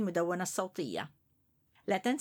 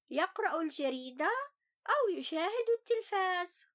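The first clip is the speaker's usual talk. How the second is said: put-on higher young boy's voice, sulky; child voice; 9 semitones higher than usual. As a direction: tell it as an upset little boy. First-person child narrator Ali.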